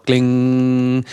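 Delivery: long, steady hummed "gling"; sustained bell-like tone; imitated ringing note. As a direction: voice one struck note and let it ring. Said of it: "we'd go".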